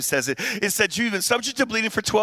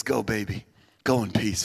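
much lower, soft and gentle; tender; 11 semitones lower, 3 dB quieter than usual. Retold or imitated. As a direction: send her off lovingly. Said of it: "He says".

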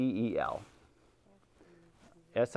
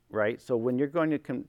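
normal talking voice

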